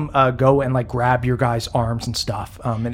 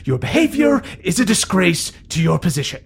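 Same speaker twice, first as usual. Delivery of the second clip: stern scolding; 6 semitones higher than usual. Acted. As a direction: scold the young rider sharply. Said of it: "as she begins chewing them out".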